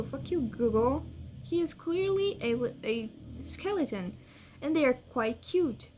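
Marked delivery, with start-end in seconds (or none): none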